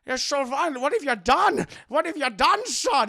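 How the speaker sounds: loud, high appalled father impression; Sean Connery. Father appalled at son's killing, loud horrified impression. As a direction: cry out aghast, with slushy s. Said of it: "And Henry's like".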